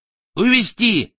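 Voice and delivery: slow, high, loud order; barked command. A narrator's reading. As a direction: order the guards curtly. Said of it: "скомандовал Азадовский". (громкая команда)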